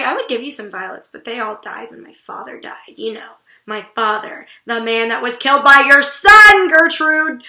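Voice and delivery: loud guilt trip aimed at the queen; guilt-tripping. Retold or imitated. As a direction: lay the guilt on thick, then hammer the accusation. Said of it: "That's a guilt trip against Gertrude".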